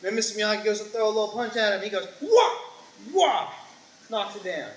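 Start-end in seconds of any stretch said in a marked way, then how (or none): 2.2-3.5 high, loud martial-arts yelps; imitated karate cries. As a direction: punch out two mock blocking shouts. Imitates the master's blocking cries.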